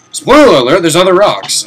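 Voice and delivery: loud and high teasing spoiler warning; mock dramatic reveal. Real, not acted. Teasing viewers with a loud spoiler. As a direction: announce it like a big secret. Playful aside while gaming.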